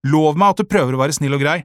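pressing, bossy plea; cross demand. A narrator's reading. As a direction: firmly demand a promise. Cross girl demands a promise.

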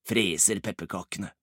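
ordinary delivery, nothing marked

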